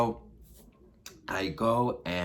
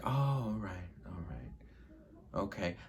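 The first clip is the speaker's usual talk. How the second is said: imitating his trailing, very soft reply; muted suspicious acceptance; 8 dB quieter than usual. Storyteller imitates date hearing about the friend, quietly suspicious. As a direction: let it sink to a barely audible grudging mumble.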